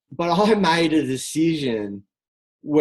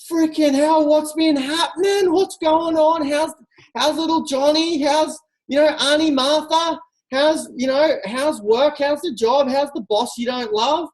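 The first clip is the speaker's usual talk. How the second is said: excited catch-up; 8 semitones higher than usual. Retold or imitated. Greeting a best friend after six months, thrilled and high. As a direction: squeal it like you haven't seen them in months. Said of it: "How would you talk to your best friend".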